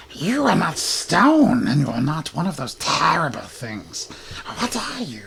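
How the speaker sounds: a curious little goblin peering up at a stranger; twitchy goblin. Acted. Goblin NPC pokes the stranger.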